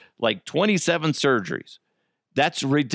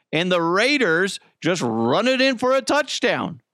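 usual speaking voice

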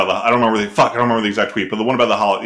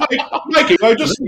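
Morty; 11 semitones higher than usual. Acted. Doing British Mad Dog as Morty on request, squeaky impression. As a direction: squeal it out in a bad British accent. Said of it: "Mad Dog as Morty".

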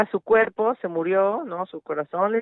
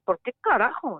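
mock tantrum; 5 semitones higher than usual. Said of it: "como un berrinche de un niño".

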